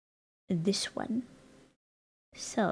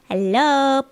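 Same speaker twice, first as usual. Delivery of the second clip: loud, bright thank-you to raiders; excited greeting; 8 dB louder, slower than usual. Real, not acted. Streamer loudly thanking a raider, excited. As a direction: greet the raid with energy.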